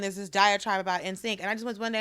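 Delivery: ordinary delivery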